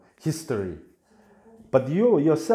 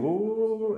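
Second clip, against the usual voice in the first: falling whoop; 10 semitones higher than usual. Retold or imitated. Falling down the hole.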